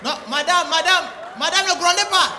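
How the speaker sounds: loud and high, pleading with the caller; hammed-up phone shout; urgent shout. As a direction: raise your voice to stop her scolding. Operator shouting to stop mother scolding.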